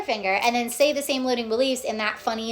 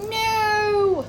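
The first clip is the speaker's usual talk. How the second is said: high wailing No; a mock anguished scream; imitated scream; 10 semitones higher, 3 dB louder than usual. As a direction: cry out No like someone breaking down. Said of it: "bawling, screaming".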